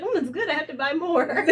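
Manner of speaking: high-pitched mock enthusiasm, laughing; teasing mimicry. Teasing a friend who bought nasty drink, high laughing mimicry. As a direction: imitate a friend's eager praise, breaking into laughter.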